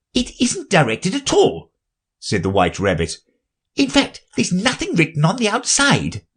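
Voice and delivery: high respectful rabbit voice. Acted. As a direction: politely correct the king, high.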